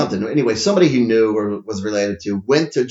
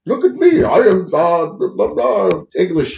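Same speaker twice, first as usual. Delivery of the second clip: mocking a daughter's failed English accent; child's botched English accent, trailing into blah-blah; bad accent imitation; 7 semitones higher, 5 dB louder than usual. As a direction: put on a bad English accent, starting high and loud. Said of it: "My daughter does that too".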